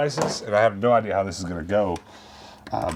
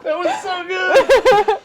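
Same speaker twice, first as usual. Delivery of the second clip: high, loud, laughing; cracking up, slow and high; 10 dB louder, much slower than usual. Real, not acted. Cracking up after impression.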